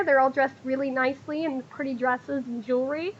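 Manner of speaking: usual speaking voice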